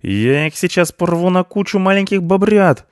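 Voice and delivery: high, loud threatening howl; yelling. A narrator's reading. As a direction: scream the threat in fury. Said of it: "завопил Рыжопиус". (яростный высокий вопль)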